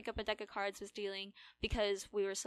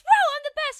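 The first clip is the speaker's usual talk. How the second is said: gloating whoop; 14 dB louder than usual. Retold or imitated. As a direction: whoop it up like a cocky winner. Imitates winner's gloating again.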